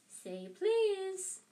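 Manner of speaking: high, loud taunting singsong; needling poltergeist falsetto; annoying singsong. A narrator's reading. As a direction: tease in a piping imp's voice. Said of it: "his annoying singing voice".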